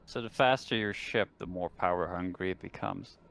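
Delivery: ordinary delivery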